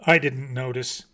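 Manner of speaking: short, curt. A narrator's reading. Mockingbird brushing off the bat's question, curt. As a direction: cut the bat off shortly. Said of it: "The mockingbird said shortly".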